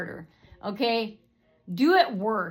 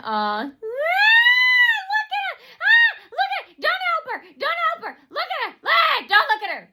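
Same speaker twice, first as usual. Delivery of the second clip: very high shrieked imitation; mock screaming; 7 dB louder than usual. Imitating hecklers screaming about a customer, mock shriek. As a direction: shriek it in short high bursts. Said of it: "they were screaming".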